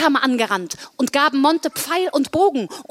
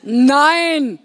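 loud, drawn-out no; called-out refusal; 3 semitones higher, 5 dB louder than usual. Acted. Everyone answers Blodi at once, exasperated shout. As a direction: cut him off with a firm, raised no. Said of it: "riefen alle gleichzeitig".

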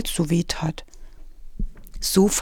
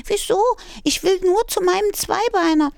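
much higher, pleading little kitten; small kitten voice; 13 semitones higher than usual. Acted. Scared kitten answers the old tomcat, high and pleading. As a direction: talk like a tiny lost kitten, high and earnest.

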